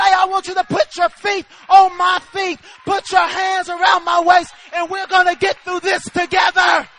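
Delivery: loud, ringing shout; rousing, shouted reassurance; Jesus' promise, preached. Acted. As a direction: proclaim each phrase with full force. Same words preached as Jesus speaking.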